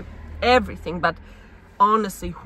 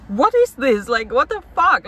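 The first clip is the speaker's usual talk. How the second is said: louder, higher, fed-up cry of what is this; exasperated outburst; 5 semitones higher, 7 dB louder than usual. Retold or imitated. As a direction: blurt it out, at the end of her rope. Frustrated inner outburst, retold to camera.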